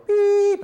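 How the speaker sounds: high, loud imitation of a tone; loud voiced beep. Shown as a demonstration. Using voice over a crying child, loud high beep. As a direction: sing out a piercing electronic beep. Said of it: "and I do it really loud".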